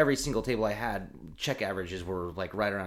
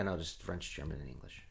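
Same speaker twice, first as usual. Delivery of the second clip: usual speaking voice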